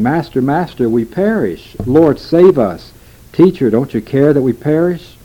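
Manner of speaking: begging the sleeping master to save them; frightened plea. Acted. Disciples wake Jesus in storm.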